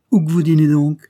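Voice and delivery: high, loud servant's demand; old peasant woman. A narrator's reading. Old servant questions the priest, loud peasant voice.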